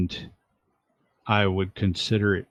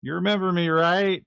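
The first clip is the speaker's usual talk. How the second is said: calling out; 10 semitones higher than usual. Acted. [name] announcing himself to Howard, high carrying call. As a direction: call your name down to someone below.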